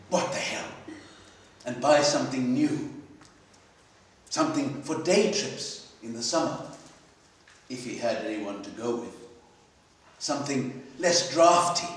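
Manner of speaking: ordinary delivery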